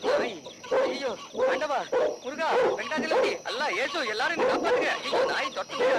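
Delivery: high, loud terrified cries; panicked screaming. Acted. Groom bitten at by a dog, screaming in panic. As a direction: scream for every god's help.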